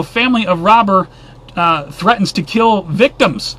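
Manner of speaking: normal talking voice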